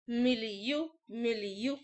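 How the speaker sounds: usual speaking voice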